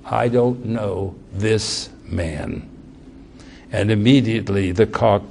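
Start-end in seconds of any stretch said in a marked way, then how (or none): 0.1-2.6 soft, low and very slow; hushed repeat. Acted. Preacher echoing Peter's denial to the congregation, hushed and slow. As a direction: let the denial sink in almost under the breath.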